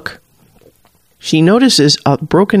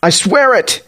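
higher-pitched, pleading protest; muffled oath; 5 semitones higher than usual. A narrator's reading. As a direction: swear it while cowering under your arms. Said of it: "his voice is muffled".

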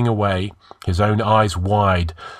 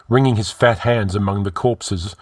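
ordinary delivery

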